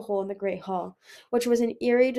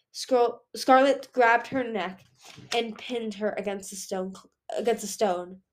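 plain reading voice

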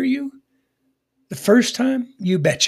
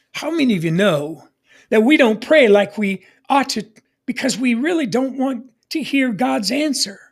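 ordinary talk

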